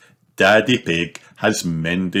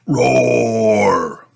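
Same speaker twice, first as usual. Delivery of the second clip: a louder, cried-out toy roar; toy dinosaur roar; 4 semitones higher, 5 dB louder than usual. Acted.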